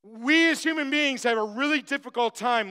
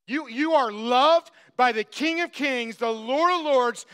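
ordinary talk

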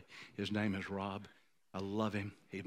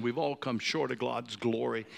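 ordinary talk

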